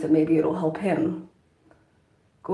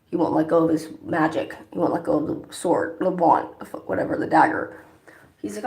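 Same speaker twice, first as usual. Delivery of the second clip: ordinary talk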